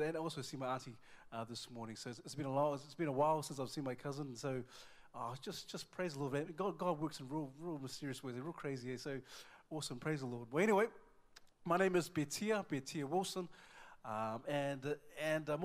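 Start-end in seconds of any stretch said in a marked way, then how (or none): none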